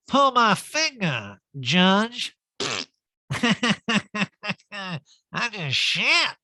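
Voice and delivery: high, loud hammed-up drawl; boozy prankster voice; mock drunk jokester. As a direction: crack a crude gag like a sloshed diva. Recapper imagines Erica joking with judges.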